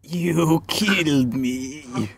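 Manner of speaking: slow, drawn-out mock accusation; hammed-up dying blame, every word stretched; Captain America. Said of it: "Or, like".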